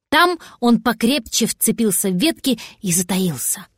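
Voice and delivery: plain reading voice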